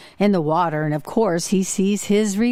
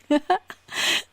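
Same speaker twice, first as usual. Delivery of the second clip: amused laugh at punchline; 8 semitones higher than usual. Real, not acted.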